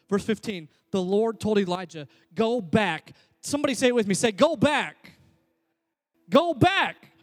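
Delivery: loud, high, driving preacher voice; full-force preaching. Acted. Pastor drilling HALT into the congregation, full-force preaching. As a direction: preach it hard and build to each point.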